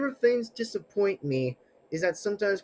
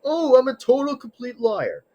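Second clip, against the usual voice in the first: mocking whiny voice; 7 semitones higher, 6 dB louder than usual. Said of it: "like, trying to say is".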